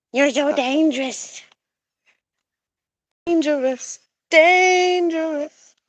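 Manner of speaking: high, cooing pet voice; playful high-pitched mock awe. Real, not acted. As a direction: tease a pet in a high voice, stretching the last word out. Teasing the pet goose.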